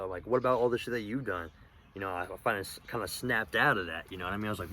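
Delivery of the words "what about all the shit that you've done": retold yell, higher and louder. Confronting his girlfriend who blames him, a retold yell. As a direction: throw her blame right back at her. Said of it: "I fucking yelled".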